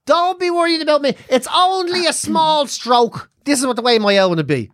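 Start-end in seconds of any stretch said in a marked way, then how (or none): 0.0-3.3 high, put-on brave reassurance; mock martyr mum. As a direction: play down a disaster as the long-suffering mother. Mother playing down her stroke.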